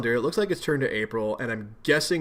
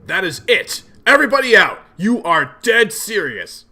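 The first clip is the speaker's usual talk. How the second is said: loud, higher stern yell ordering them out; angry scolding; 5 semitones higher, 9 dB louder than usual. Acted. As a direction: throw everyone out, furious. John scolds imps to leave.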